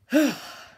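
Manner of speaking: high, loud sigh dropping off; voiced sigh of release; sigh with sound. Shown as a demonstration. Coach repeating the exercise for class, sigh with sound. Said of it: "letting out the sound sigh".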